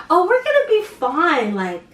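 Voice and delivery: casual, unbothered, hiding the stress; nonchalant. Retold or imitated. Reassuring boyfriend while broke, faked nonchalance. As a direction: act totally relaxed while panicking inside. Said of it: "I can play the most nonchalant person".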